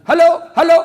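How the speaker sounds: loud, high phone bellow; acted villager yelling hello; hollering into phone. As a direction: shout down a line nobody answers.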